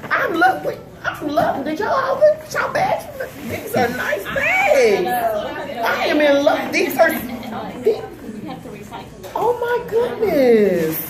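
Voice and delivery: high-pitched delighted squealing; excited gushing. Real, not acted. Mother admiring everyone's gift bags, excited. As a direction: gush high, stretching the last words.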